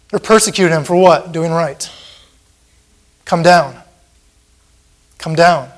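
normal talking voice

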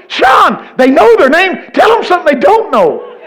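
high, loud hollering; retold exasperated shout. Preacher telling Sean off over calling names, high mock shout. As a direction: holler as if correcting a friend. Retells shouting at his friend.

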